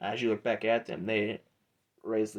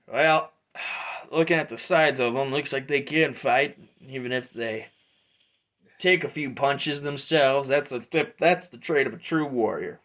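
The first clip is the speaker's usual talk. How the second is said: louder, sizing-up mercenary voice; approving Drudge; 4 semitones higher, 5 dB louder than usual. Acted. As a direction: size up the new fighters, louder.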